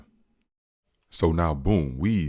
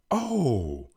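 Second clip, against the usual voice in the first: much higher, rising to loud starstruck gush; excited recognition; 4 dB louder, much slower than usual. Retold or imitated. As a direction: gush like a fan spotting a star. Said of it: "he excited".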